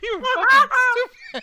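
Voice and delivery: very slow, high words through laughter; laughing. Real, not acted. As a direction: gasp it out mid laughing fit. Laughing fit continues.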